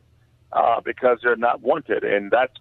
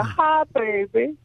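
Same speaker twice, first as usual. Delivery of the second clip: put-on Southern accent; 10 semitones higher than usual. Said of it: "Missus Spears ... Southern Baptist ... from Mississippi".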